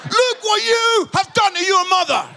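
high-pitched, loud dad yell; re-enacted parental bellow, building on the repeat; angry shouting. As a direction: shout an outraged scolding at your son. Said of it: "shouting at Jordan".

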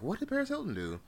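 high baffled voice. Retold or imitated. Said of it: "I was like".

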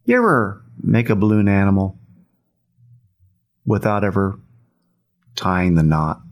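slow delivery with long pauses; unhurried question in three separate chunks; real slow. Retold or imitated. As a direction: draw it out slowly, pausing between phrases. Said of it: "he says it just like that, real slow".

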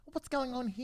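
high, thin imitation of her; high quoted voice. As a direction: pitch it up as her. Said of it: "she was like".

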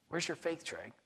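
very soft recalled question, dropping away; gently hushed questioning, imitated; still small voice. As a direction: ask it quietly, like an inner voice. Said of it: "a still small voice".

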